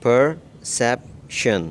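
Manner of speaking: Perception drawn out slowly, syllable by syllable; very slow teacher demo of perception; slow model word. Shown as a demonstration. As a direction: stretch perception out slowly for learners. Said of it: "pronunciation है".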